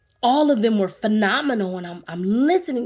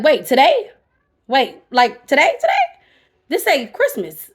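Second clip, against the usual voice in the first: louder, higher, incredulous double-take; retold surprise; 4 semitones higher, 7 dB louder than usual. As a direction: replay the shock of finding out it's today. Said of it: "I was like".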